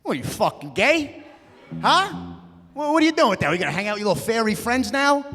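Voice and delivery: high, loud mocking jeer; bully dad sneering at his son. Dad mocks son's pierced ears, loud and high. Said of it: "He's like".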